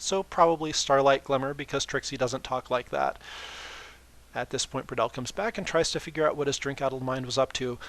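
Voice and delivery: ordinary talk, nothing marked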